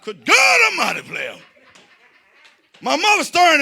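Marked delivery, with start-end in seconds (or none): 0.0-1.4 preacher shout. Acted. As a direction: belt it like a sermon climax. Preacher exclamation.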